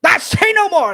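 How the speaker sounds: very high, loud wizard cry; excited old wizard. Acted. Wizard stopping Barbo's tale, very high and loud. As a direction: cut him off with a high squeal. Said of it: "He goes".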